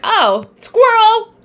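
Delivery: high, loud, slow mock delight; mock distracted. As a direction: drag out the squirrel like a distracted kid. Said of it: "and I'm like".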